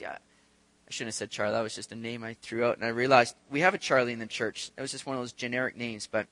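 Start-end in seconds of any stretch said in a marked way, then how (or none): none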